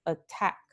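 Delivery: "attack" with stress on the second vowel; acento final. Shown as a demonstration. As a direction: lean on "-tack" in "attack". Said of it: "esta vocal acentuada". ("attack" con la segunda vocal acentuada)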